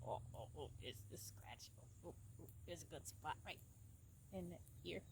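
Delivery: soft tender whisper. Real, not acted. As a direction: breathe it gently to the horse. Whispering to horse.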